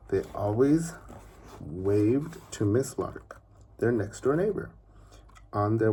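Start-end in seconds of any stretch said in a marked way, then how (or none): none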